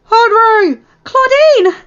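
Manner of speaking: high, loud cry of names; alarmed shout. Acted. Teammates calling to Henry and Claudine, shouting. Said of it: "Morgan shouted at the same time as Derek".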